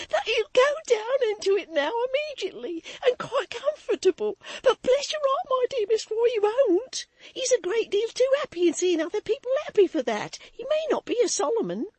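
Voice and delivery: high, loud, laughing hysterically; hysterical maid. A narrator's reading. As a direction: gush with nervous laughter. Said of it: "violent hysterical laugh".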